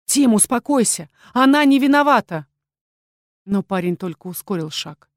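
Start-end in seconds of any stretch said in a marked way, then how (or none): 0.1-2.5 громко и выше, успокаивая брата; крикни, заступаясь за девушку. A narrator's reading. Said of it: "Лайн успел крикнуть". (громкий крик заступника)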